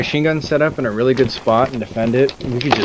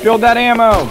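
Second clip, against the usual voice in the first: loud urgent call to teammates; shouting; 9 semitones higher, 4 dB louder than usual. Real, not acted. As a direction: yell the order out. Urging team to build ammo.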